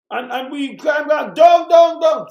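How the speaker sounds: indignant shouting of down down down; emphatic shout. Real, not acted. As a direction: bellow each down harder. Rant on crime falling.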